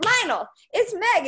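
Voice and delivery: high, slow and loud, announcing herself; beaming self-introduction, drawn out; excited greeting. Retold or imitated. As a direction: call out his name like an eager fan. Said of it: "I'm like".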